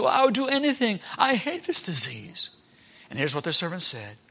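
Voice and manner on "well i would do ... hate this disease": indignant. Acted. Captain answers again.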